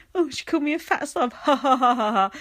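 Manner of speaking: mock forced laughter. Mocking James's fake laughter.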